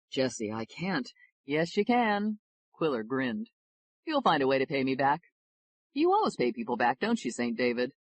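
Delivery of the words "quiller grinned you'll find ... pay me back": plain reading voice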